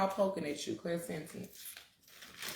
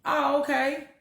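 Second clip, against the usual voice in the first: higher, startled "Damn. Oh, okay."; surprised realization; 8 semitones higher, 3 dB louder, slower than usual. Retold or imitated. Speaker retells her startled realization, pitched up. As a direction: catch yourself in surprise, pitched up. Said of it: "I'm like".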